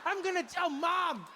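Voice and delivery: put-on high, whining child's reproach; wronged child. Kid scolding the dad who left him, mock tearful whine. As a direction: wail it as an aggrieved little boy. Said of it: "I know this kid was like".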